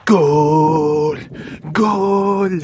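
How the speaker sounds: loud commentator goal cry; goal shout. Pretend. Pretending to score, loud goal shout. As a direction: yell goal like a stadium announcer.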